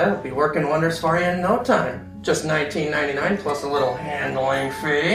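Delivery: ordinary talk, nothing marked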